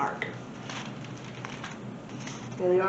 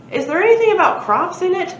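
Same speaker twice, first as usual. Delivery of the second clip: eager question; 10 semitones higher, 7 dB louder than usual. Acted.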